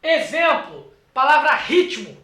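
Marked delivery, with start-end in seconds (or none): none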